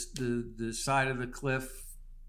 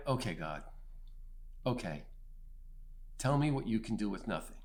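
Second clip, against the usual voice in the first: low and much softer, yielding; humbled surrender; 4 semitones lower, 8 dB quieter, faster than usual. Retold or imitated. Broken man finally surrendering to God: low soft yielding. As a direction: hand it over quietly, beaten.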